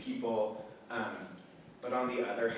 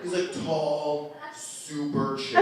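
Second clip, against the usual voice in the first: mock chill description; 3 semitones higher, 5 dB louder, slower than usual. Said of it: "they're like".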